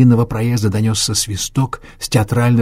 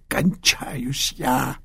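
very slow, high failing voice; dying man groaning; 4 semitones higher, 4 dB quieter, much slower than usual. Acted. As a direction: fade out like dying.

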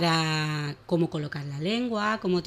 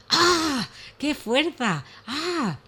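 high grunting effort as he pulls; mock straining cries; 8 semitones higher, much slower than usual. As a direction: heave with all your strength. Horse strains pulling branch.

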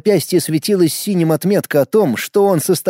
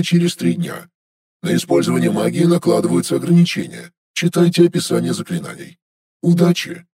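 system announcement. Acted. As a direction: read it like a calm automated notice. System message read.

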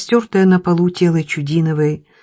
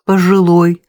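ordinary delivery, nothing marked

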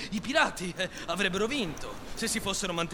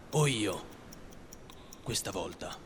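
low, hushed, deliberate pauses; grave vow; 10 semitones lower, 8 dB quieter than usual. A narrator's reading. Peter's oath against Hook, low grave vow. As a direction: drop low and let the oath fall softly.

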